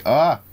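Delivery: short, loud, high "ah"; startled yelp. Real, not acted. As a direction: cry out as if suddenly hit. Cries out while getting hit.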